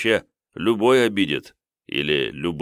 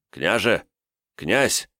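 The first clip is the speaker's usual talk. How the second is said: calling out; 3 semitones higher, slower than usual. Acted. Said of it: "закричал".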